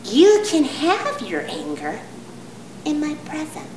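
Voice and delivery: slow, soft, overly calm condescension; patronizing spiritual type. Retold or imitated. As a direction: soothe her with smug gentleness. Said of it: "people who like to tell you".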